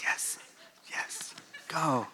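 a tiny whispered go; whispered cheer. Real, not acted. Speaker cheering his home team in a whisper. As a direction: breathe the cheer out under the breath.

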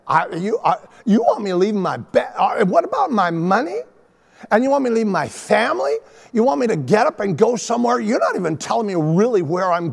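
mock-indignant questions, climbing in pitch; incredulous protest. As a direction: balk at each demand, piling up objections. Acts out balking at God's command.

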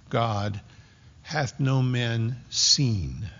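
"God" first and weighted, "hath no man seen" drawn out slowly; emphasis on God. Shown as a demonstration. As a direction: lean on "God", then slow the rest. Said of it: "It's emphatic. There's an emphasis there".

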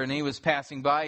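ordinary talk, nothing marked

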